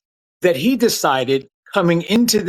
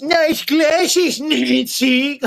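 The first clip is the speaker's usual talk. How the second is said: mock heckler; 10 semitones higher, much slower than usual. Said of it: "He says".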